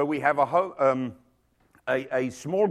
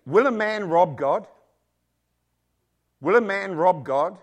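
raised, louder rhetorical challenge; emphatic, louder repeated question; 2 semitones higher, 5 dB louder than usual. Acted. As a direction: press the question home, twice. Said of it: "it says this very, very emphatically".

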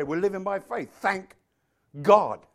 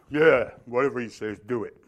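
low, offhand sidekick voice; Aaron; 7 semitones lower than usual. Acted. Brother backing up the stuttering speaker, low acted voice. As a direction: back him up in a low voice. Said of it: "And Aaron".

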